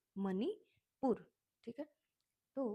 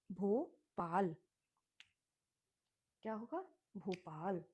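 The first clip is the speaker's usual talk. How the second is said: slow teaching. Shown as a demonstration. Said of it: "B H O भो और P A L पाल".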